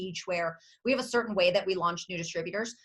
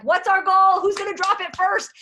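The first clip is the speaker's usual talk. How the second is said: loud, high rallying shout; rallying call; 10 semitones higher, 8 dB louder than usual. Retold or imitated. Rallying the team in a training, loud high shout. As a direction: fire up the team like a coach. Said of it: "I'm like".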